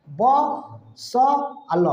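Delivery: ब स अल broken into slow, separate pieces; syllable by syllable. Shown as a demonstration. Teacher sounds out a word for pupils, syllable by syllable. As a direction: sound out ब, स, अल one at a time. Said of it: "बसल बोलोगे".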